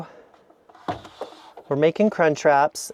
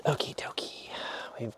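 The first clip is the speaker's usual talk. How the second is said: whispering; 2 semitones lower, 14 dB quieter than usual. Real, not acted. Whispering while storing meat.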